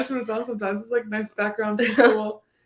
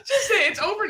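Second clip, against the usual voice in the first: laughing; 12 dB louder than usual. Real, not acted. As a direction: speak while still laughing. Laughing after story.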